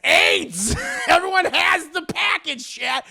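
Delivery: loud, high, cracking up in disbelief; gleeful high-pitched outburst; shouted punchline. Real, not acted. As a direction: holler the big reveal to the audience.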